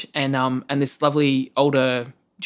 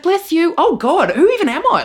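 very high and louder, mock-appalled; squeaky self-horror; 6 dB louder than usual. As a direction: wail at your own niceness. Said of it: "I was like".

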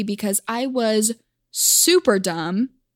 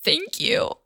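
tearful thanks; 9 semitones higher, 4 dB quieter than usual. Retold or imitated. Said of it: "in between sobs, I just say".

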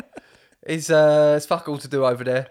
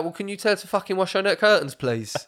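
mock-polite retort, higher and quicker; mimicking nan; 7 semitones higher, 4 dB louder than usual. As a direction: throw the polite tone back at the copper. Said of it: "She's like".

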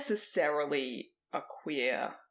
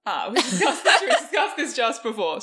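laughing; 5 semitones higher, 9 dB louder than usual. Real, not acted. Laughing at repeated question.

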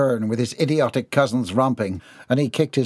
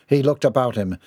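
ordinary delivery

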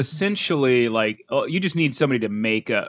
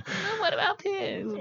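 very high, slow, soft squeaky pig voice; voicing the pigs; 8 dB quieter than usual. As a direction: play the snubbed pigs pleading. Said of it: "they're like, they're the outcasts".

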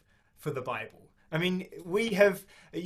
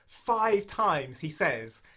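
ordinary talk, nothing marked

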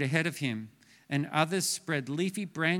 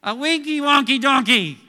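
hammed-up punchline; 7 semitones higher, 10 dB louder than usual. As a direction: sell the dad joke big. Dad joke punchline.

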